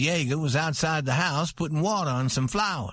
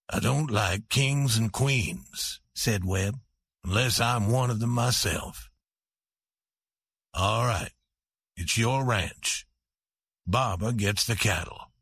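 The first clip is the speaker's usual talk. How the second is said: bitter husband; 5 semitones lower than usual. A narrator's reading. Webb yielding the ranch to his wife, low and cold. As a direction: give in with cold resentment.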